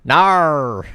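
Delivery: high, loud Australian NAR; exaggerated Australian "Nar"; mock Australian no. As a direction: belt out NAR as a hammed-up Australian. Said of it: "Australian man".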